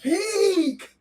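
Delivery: high, loud, slow hype shout; excited shout. Real, not acted. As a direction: proclaim it like the best moment ever. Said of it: "When I say".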